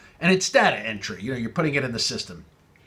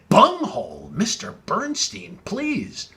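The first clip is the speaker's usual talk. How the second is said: higher, mock-outraged host voice; mock indignation; 6 semitones higher than usual. Host scolding the rude caller, mock outrage. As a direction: scold him in playful outrage.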